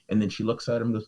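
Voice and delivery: plain reading voice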